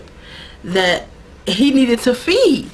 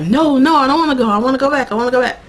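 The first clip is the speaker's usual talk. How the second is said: mildly pleading, raised pitch; Sookie; 5 semitones higher, much faster than usual. Retold or imitated. Sookie begs to go back, mildly distressed. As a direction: protest with a touch of panic.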